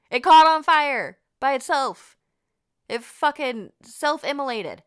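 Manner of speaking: loud, high storyteller's outburst; incredulous exclamation. Real, not acted. Storyteller stressing that the toy lit itself, loud and incredulous. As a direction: belt out the shocking reveal.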